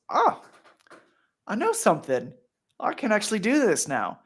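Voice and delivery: high, pleased "oh" of discovery; dawning realization. Acted. Host voicing a newly educated client, pleasantly surprised.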